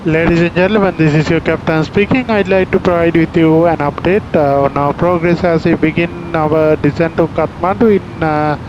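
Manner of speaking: calm airline PA voice; formal in-flight announcement; captain announcement. Real, not acted. Pilot's cabin PA announcement.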